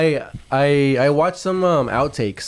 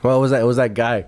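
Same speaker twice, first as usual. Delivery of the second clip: ordinary delivery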